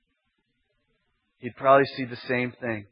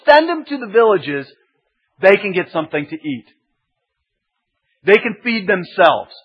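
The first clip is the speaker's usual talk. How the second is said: loud, pushy, high, arguing with Jesus; exasperated disciples; 5 semitones higher, 9 dB louder than usual. Retold or imitated. Disciples telling Jesus to send the crowd away, loud and exasperated. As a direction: scoff at the idea and push back hard. Preacher voices doubting disciples.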